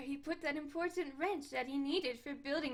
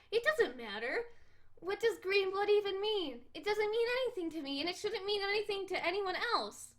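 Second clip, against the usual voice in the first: put-on defiant cat girl; 5 semitones higher than usual. Cat girl protesting that blood color doesn't matter, defiant. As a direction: insist like a stubborn kitten.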